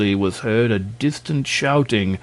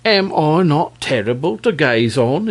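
proud; 4 semitones higher than usual. Acted. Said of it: "inquired the dragon proudly".

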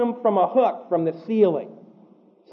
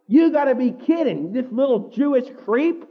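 scornful disbelief; 6 semitones higher than usual. Retold or imitated. Commander mocking Paul's citizenship, high scornful scoff. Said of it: "That's the inflection there".

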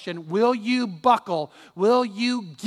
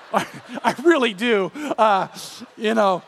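laughs through the words; real laugh at his own joke; chuckling as he admits he means it; 2 semitones higher, 4 dB louder than usual. Real, not acted. Joke about God using Pam.

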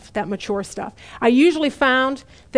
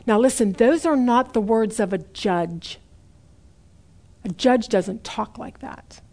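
ordinary talk, nothing marked

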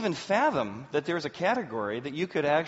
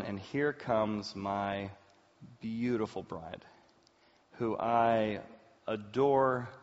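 tender reminiscence; 6 semitones lower, 4 dB quieter than usual. Real, not acted. Groom recalling his bride at the altar, tender reminiscence. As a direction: savour each phrase softly, with pauses. Tender wedding memory, slowed and lowered.

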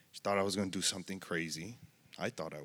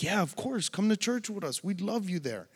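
much higher, bright eager welcome; excited invite; 10 semitones higher than usual. Retold or imitated. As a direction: imitate her thrilled reply, pitched up. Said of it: "She's like".

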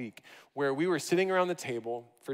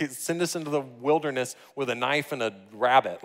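ordinary talk

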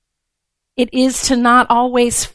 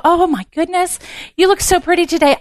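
high, bright-eyed gush of praise; mock-gushing friend paying a compliment; 7 semitones higher, faster than usual. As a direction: lay the compliment on sweetly, pitched up. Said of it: "you go".

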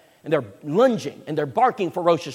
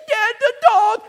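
put-on high, louder child's cry of alarm; alarmed kid; 7 dB louder, slower than usual. Child calling to dad as the dog gets shocked, high and alarmed. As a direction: call out like a scared little kid. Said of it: "And the kid said".

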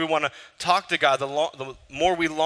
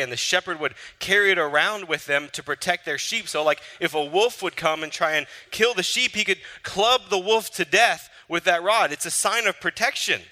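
louder, lifted preacher climax; building preaching; 5 semitones higher, 3 dB louder than usual. Acted. Preacher describing the shepherd clubbing the wolf, building. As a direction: build to the protection point.

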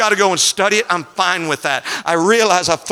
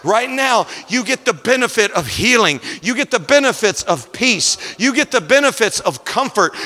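climbing sermon cadence over the organ; rising pulpit fervor, held strong; preaching at full force; 4 semitones higher than usual. Acted. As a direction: preach it home to the congregation. Preaching to congregation with music.

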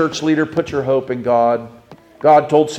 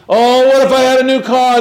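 higher-pitched mock of someone always wanting more; excited wishful voice; 8 semitones higher, 4 dB louder than usual. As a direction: lightly voice an eager, wishful dreamer. Voicing a discontented person's wish.

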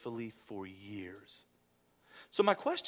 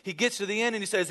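normal talking voice

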